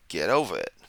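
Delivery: lightly put-on New York accent; casual New York dismissal. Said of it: "Broke out to New York there".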